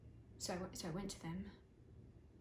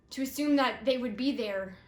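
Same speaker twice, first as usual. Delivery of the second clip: much louder and higher on they would be there; emotional peak; 4 semitones higher, 12 dB louder than usual. Acted. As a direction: hit they would be there hard and high. Emotional peak, repeated phrase.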